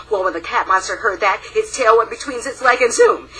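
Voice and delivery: ordinary delivery, nothing marked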